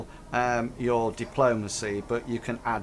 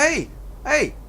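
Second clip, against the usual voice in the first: much higher, louder pleading hey; Hank voiced; 13 semitones higher, 3 dB louder than usual. GM voicing Hank calming the intruders, high and raised. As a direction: call it out high to calm them. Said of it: "like, says".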